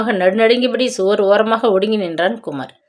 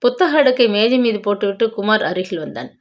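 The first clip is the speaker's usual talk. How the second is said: ordinary delivery, nothing marked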